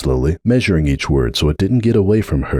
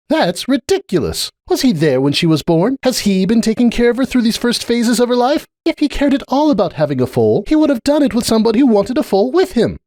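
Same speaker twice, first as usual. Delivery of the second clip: much higher, indignant voice; a scornful mare scoffing at the idea; Cupcake. A narrator's reading. Cupcake dismissing Sunburst as a father, indignant.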